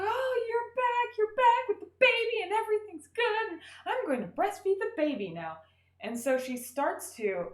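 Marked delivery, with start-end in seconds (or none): 0.0-5.6 hammed-up deluded happy mom; mock delirious mother. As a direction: coo it like a blissed-out dreaming mother.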